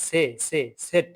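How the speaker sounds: সে with a ts onset; ts sound. Shown as a demonstration. Teacher showing the German Z sound. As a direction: say সেট starting with ts. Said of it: "এটা স আসবে একটা".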